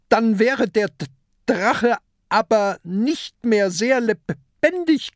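high, loud stammering sarcasm; mock the monster's excuse; pirate lecturing the kraken; sarcastic scolding. A narrator's reading. Peter keeps scolding the kraken.

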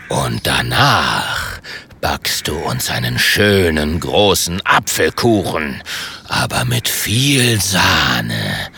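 tiefes, gedehntes Drohen eines Drachen; herrischer Drache. Acted. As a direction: fahr ihn tief und langsam an. Drache befiehlt dem Ritter. (herrisch drohender, tiefer Drache)

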